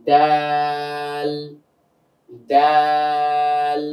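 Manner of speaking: দাল stretched very slowly and evenly; drawn out. Shown as a demonstration. Teacher drilling the class on slow drawn দাল. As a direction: pull দাল out long, unhurried. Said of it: "টেনে টেনে এভাবে বলতে হবে".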